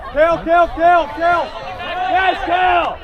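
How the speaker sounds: loud chanting; frat bro. Real, not acted. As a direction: bark it out in a chant. Sideline chant during play.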